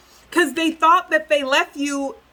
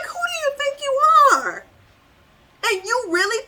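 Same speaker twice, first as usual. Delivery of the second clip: indignant shout; 12 semitones higher than usual. Real, not acted. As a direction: shout it up high at their nerve. Reader scolds the person who took it.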